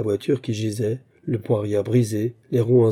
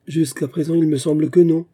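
pitched up and louder, cutting; indignant sharp retort, higher and louder; 6 semitones higher, 5 dB louder than usual. Acted. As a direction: throw it back at him.

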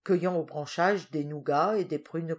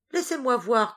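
a higher, louder playful order; commanding; 7 semitones higher, 6 dB louder than usual. A narrator's reading. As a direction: tease him while giving the order. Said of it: "commanda-t-elle".

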